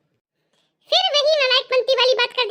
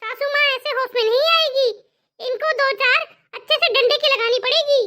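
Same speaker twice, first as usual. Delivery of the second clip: ordinary delivery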